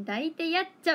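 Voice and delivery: high, loud Toyama dialect phrase; real Toyama dialect. Shown as a demonstration. Showing viewers the real phrase, loud Toyama dialect. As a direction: say it like a true Toyama local. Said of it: "は言うよ".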